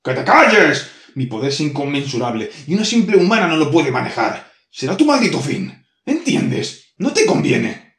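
loud, high furious demon tirade; enraged boss roar. Acted. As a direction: roar her down, building fury. Demon shouts her down.

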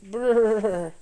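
lips buzzing with cold; shivering brrr. Acted. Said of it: "chattered the man".

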